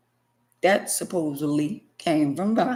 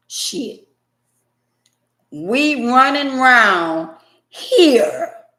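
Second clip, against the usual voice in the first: loud, slow, drawn-out recital of the verse; dramatic reading; 7 dB louder, slower than usual. Acted. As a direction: belt the verse out slowly like a sermon.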